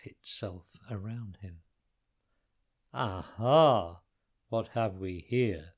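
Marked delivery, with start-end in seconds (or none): 2.9-5.7 low, booming giant voice. Acted. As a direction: boom it like a giant. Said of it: "boomed the giant".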